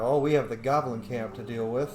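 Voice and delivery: usual speaking voice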